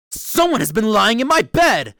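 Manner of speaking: loud, booming great big bear; gruff big bear. A narrator's reading. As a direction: roar it out as a rough, gruff bear. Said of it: "in his great, rough, gruff voice".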